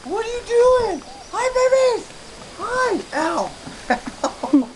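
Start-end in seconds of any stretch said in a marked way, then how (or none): 0.0-3.0 high, sing-song cooing at the puppies. Real, not acted. Owner greeting tiny puppies in high cooing baby talk. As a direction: coo up high like talking to babies.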